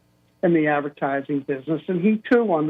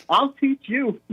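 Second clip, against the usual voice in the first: mock triumph; 4 semitones higher, slower than usual. Mock triumph after gorilla imitation.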